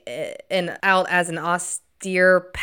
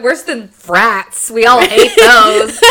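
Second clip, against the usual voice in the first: laughing; 5 semitones higher, 12 dB louder, much slower than usual. Retold or imitated. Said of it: "I thought".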